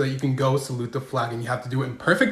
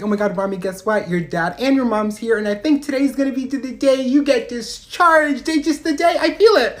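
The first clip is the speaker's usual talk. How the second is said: hopeful gushing that climbs steadily higher; excited announcement; 7 semitones higher than usual. Retold or imitated. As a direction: build from eager news to high-pitched certainty. Hopeful social worker announces good news.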